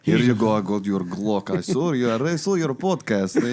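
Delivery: put-on Russian accent, slowing at the end. Stranger handing a podcaster a Glock after a show, Russian accent. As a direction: hand it over like a Russian stranger. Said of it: "That was a good Russian accent".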